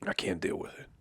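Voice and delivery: whispered, weary repeat. Real, not acted. Podcaster giving up on the football crowds, whispered. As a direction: breathe it out under your breath.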